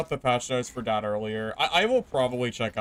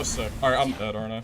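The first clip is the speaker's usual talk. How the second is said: ordinary talk, nothing marked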